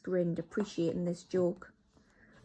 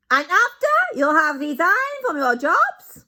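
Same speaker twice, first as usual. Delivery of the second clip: put-on much higher, loud accented voice; Grand High Witch; 10 semitones higher, 13 dB louder than usual. Grand High Witch continues orders, loud accented. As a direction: carry on instructing.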